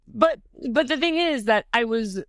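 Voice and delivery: normal talking voice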